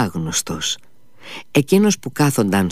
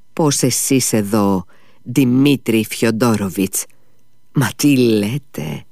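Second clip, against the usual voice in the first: ordinary delivery, nothing marked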